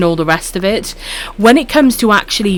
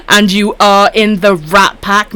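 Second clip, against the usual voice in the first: ordinary talk, nothing marked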